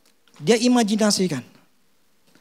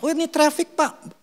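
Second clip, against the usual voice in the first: excuse; 7 semitones higher than usual. Retold or imitated. Late man excuses himself to Womack.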